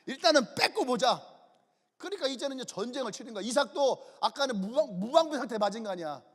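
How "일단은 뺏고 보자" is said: louder, punched declaration; mock-resolute battle decision; emphatic decree. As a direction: announce the plan forcefully. Repeats the decision forcefully.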